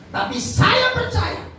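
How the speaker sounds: high, slow, ringing declaration; emphatic preaching. Real, not acted. Preacher declares faith to congregation, high and slow. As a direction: proclaim it with drawn-out conviction.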